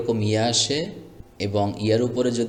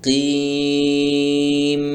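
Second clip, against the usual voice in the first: কিম with a long higher ee closed on m; stopping pronunciation; 3 semitones higher, 5 dB louder, much slower than usual. Shown as a demonstration. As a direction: chant কিম drawn out, ending on a hummed m. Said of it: "এই যে এরকম".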